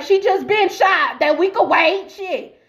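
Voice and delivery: loud, high protest on someone's behalf; retold indignant yell; indignant outburst. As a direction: imitate an outraged bystander hollering. Lady protests to the police officer.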